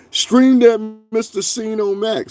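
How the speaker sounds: high, slow, put-on voice reading spam; sarcastically voicing a chat bot promo; mocking chat reader. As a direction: sneer the message out in a silly voice. Reading a chat spam message aloud.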